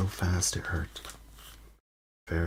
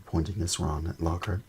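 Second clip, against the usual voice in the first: ordinary delivery, nothing marked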